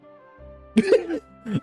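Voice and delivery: high, wheezy burst of laughter; snickering laugh. Real, not acted. Streamer amused by their own idea, snickering. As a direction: crack up at your own idea.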